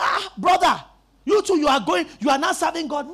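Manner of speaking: a loud mock reproach; scolding churchgoer. Churchgoer chiding a brother who doesn't serve, mock reproach. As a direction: hail a lazy brother.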